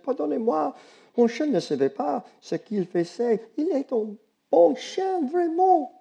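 put-on high young girl's voice in French. Girl apologizing to the neighbor in French, high girlish voice.

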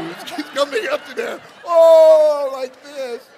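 high, loud rising overwhelm; overwhelmed surge. Retold or imitated. Telling how the emotion rose in him, high loud surge. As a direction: let the feeling surge up and break.

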